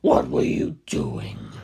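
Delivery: deep, slow, menacing giant; towering fighter greets the hero in a deep slow voice; Kaz voice. A narrator's reading. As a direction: loom over him with a low slow growl.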